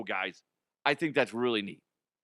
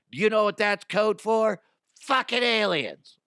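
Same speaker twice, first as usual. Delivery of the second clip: mock-breathless reveal, climbing higher; TikTok conspiracy guy; 5 semitones higher than usual. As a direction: imitate an online loudmouth, building to the payoff. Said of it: "some dickhead on TikTok be like".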